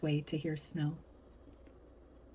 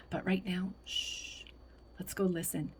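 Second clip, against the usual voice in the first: soft, hushed invitation; gentle hushing; 7 dB quieter than usual. A narrator's reading. Grandma invites Lena to listen for snow, hushed. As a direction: drop to a soft shush.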